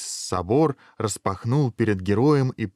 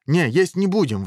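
louder, higher contradiction; firm objection; 9 semitones higher, 5 dB louder than usual. A narrator's reading. Dragon head contradicting the other, emphatic objection. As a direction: overrule it at once, high and loud. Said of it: "возразила голова Иван".

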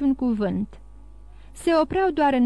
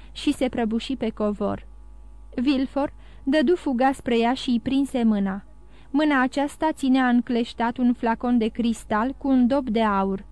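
plain reading voice

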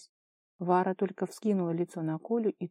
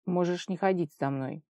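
ordinary delivery, nothing marked

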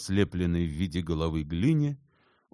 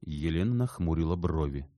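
ordinary delivery

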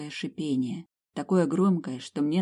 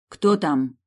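firm, louder demand; decisive question; 7 dB louder than usual. Acted. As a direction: ask it boldly into the dark. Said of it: "спросила решительно".

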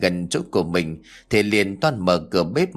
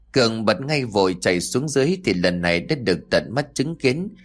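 ordinary delivery, nothing marked

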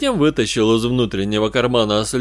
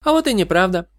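higher-pitched, eager protest; heated objection; 9 semitones higher, faster than usual. A narrator's reading. Katie contradicts the detective, heated objection. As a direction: object hotly, pitch lifted. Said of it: "горячо возразила Кэти".

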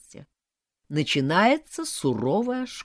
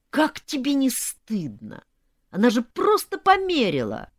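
indignant scolding; 8 semitones higher, 4 dB louder than usual. A narrator's reading. Sisters to bride for upsetting mother, scolding. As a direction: shame her with righteous outrage.

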